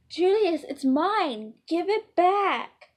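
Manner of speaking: put-on high, loud, furious kid's yell; screaming child. Little prince screams at his brother over a toy, loud and high. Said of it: "Brayden screams".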